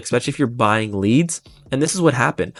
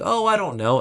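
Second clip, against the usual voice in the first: unsure client; 7 semitones higher than usual. Retold or imitated. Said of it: "She's like".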